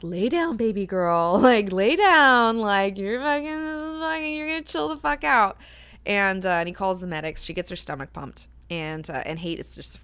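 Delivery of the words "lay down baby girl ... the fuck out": slow, higher, mock-soothing command; a light hammed-up calm-down; soothing order. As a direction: Duncan ordering a drugged woman to lie down, mock soothing. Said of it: "he's just like".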